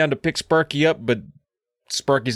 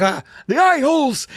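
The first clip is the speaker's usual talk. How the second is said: high, louder sudden exclamation; desperate idea; 11 semitones higher, 4 dB louder than usual. Retold or imitated. Steve tries the eye holes to pull off the mask, high sudden cry. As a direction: seize on a last hope. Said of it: "And he's like".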